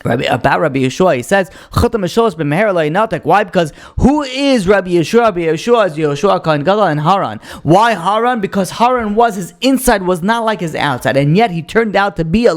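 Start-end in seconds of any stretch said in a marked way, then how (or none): none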